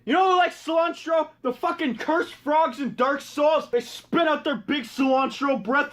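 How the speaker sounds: ordinary talk, nothing marked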